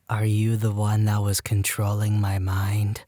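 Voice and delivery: slow, eerie whispered question; creepy whisper. Acted. Girl after a blank stare asks about mind control, creepy slow whisper. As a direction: whisper it slowly, like someone unhinged.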